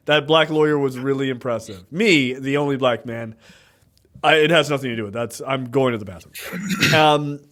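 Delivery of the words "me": normal talking voice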